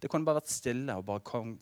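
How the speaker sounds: usual speaking voice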